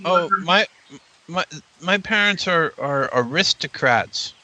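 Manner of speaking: a hint of a child faltering over the answer; lightly voiced child. Child answering a stranger about parents, lightly hesitant.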